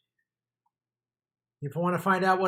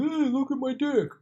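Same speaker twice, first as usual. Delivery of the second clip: put-on childish troll; 10 semitones higher, 5 dB louder than usual. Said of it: "acting like a twelve-year-old".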